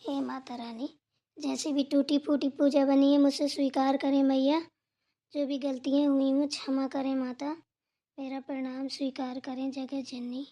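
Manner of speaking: low, soft devotional murmur; hushed prayer. Acted. Worshipper asks Mata Rani to forgive mistakes, hushed prayer. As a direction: pray quietly and low, asking forgiveness. Praying to the goddess after puja.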